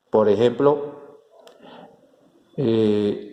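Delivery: usual speaking voice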